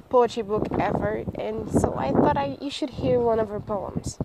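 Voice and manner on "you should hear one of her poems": ordinary delivery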